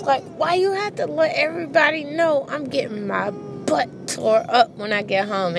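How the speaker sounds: put-on high, whiny kid voice complaining to mom. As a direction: whine it up high like an embarrassed kid. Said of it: "it'd be like".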